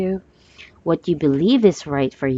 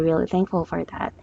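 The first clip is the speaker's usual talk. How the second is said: ordinary delivery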